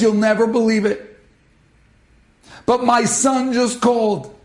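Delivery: high, loud, overjoyed mother; tearful joy. Retold or imitated. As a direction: tell it like a mother weeping with happiness. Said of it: "she's crying again".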